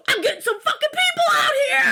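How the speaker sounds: high, fast hollering; hammed-up panicked bellow; mock furious shout. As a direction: imitate a man yelling as he storms off. Imitating Josh storming off for help.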